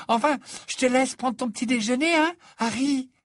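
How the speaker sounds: very high, loud, breezy farewell; singsong, airy Fleur voice; gushing young Frenchwoman. Acted. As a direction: sign off effusively in a high voice. Fleur's character voice.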